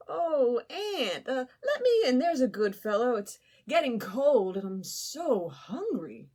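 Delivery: high, wheedling beggar with a laughing whine; pleading Grasshopper. Acted. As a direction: coax your way inside. Grasshopper begging at the door.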